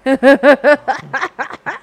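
laugh. Real, not acted. Laughs at own story.

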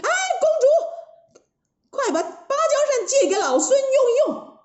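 high, hollering storybook monkey; shouting from inside. Acted. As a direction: call out as Monkey King from inside a belly. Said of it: "在铁扇公主的肚子里面大叫".

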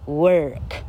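long curled vowel inside work; long r-coloured vowel. Shown as a demonstration. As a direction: work slowed to a drawn-out "werrk". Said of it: "而且拉长音".

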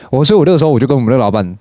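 normal talking voice

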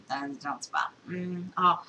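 normal talking voice

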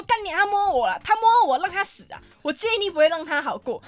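loud, high outraged yelling; angry shout. Retold or imitated. To the station staff about the groper, angry shout.

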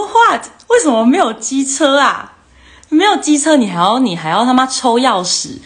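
high, loud incredulous "What?" that stays indignant; retelling a stunned, outraged reaction; shocked disbelief. Retold or imitated. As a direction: blurt it out in disbelief at the boys. Girls shocked boys have no scooters.

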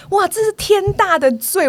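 high, slow outraged exclaim; indignant outcry. Retold or imitated. Teacher hearing the false accusation: slow high outcry. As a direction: throw up your hands in disbelief, up high and slow. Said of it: "我说".

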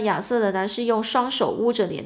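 usual speaking voice

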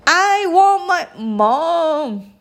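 put-on high, drawn-out child's call; storybook hooting wail; mock hoot. A narrator's reading. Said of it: "hooted the little princess".

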